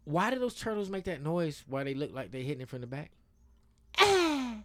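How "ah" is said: high, loud "Ah!"; turtle mating moan. Retold or imitated. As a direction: let out a high-pitched mock turtle moan. Imitates the turtles' mating noise.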